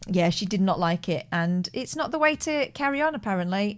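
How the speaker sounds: usual speaking voice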